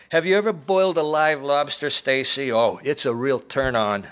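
loud, high menacing taunt; angry leer. A narrator's reading. Noonan taunting Stacy with the live lobster, angry leer. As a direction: leer at her with barely held anger. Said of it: "He leered, but it was an angry leer".

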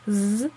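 S drawn out as a humming "zzz"; S as a sustained voiced z sound. Shown as a demonstration. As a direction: let S buzz like a bee. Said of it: "summend, wie das Summen einer Biene".

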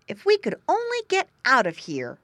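a piglet longing to get out; piglet grunting. A narrator's reading. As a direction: piglet wishing aloud. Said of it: "grunted Squinty".

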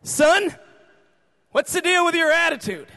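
high, very loud acted demand; stern confrontation. Father confronting son in the bathroom, loud stern demand. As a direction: play the booming dad demanding answers.

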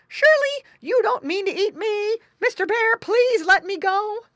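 high, loud frightened piglet voice; squealing plea. A narrator's reading. Little pig begs bear for mercy, squealing. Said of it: "squealed the little pig boy".

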